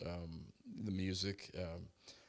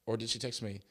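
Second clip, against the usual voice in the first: ordinary talk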